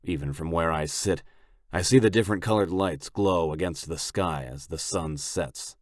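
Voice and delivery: plain reading voice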